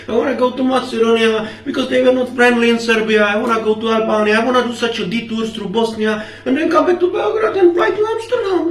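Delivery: mildly put-on tourist enthusiasm; playing eager tourist. Tourist listing his route to the officer, mock eager.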